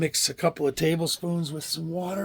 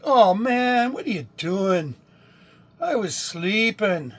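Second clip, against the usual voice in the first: mock complaint, higher and drawn out at the end; woken cat's voice; 4 semitones higher, 6 dB louder, slower than usual. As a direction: whine as a cat woken from its nap. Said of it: "He's like".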